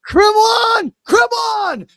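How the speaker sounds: loud, high, urgent come on; will the super chat to land, yelling; excited shouting. Real, not acted. Streamer urging the incoming super chat, high and loud.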